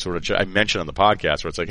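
ordinary talk, nothing marked

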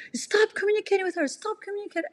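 mock yelling. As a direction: mimic his frantic shouting. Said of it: "he's just yelling".

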